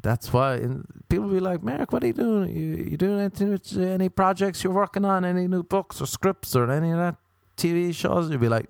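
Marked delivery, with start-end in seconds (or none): 1.6-8.3 high, chirpy questioning voice; high mocking voice of others. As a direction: pitch way up as a nosy asker. People asking him, voiced.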